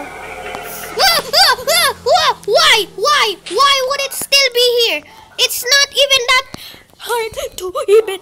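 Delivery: panicked high-pitched yelling; scared screaming. Real, not acted. Monster suddenly back, panicked.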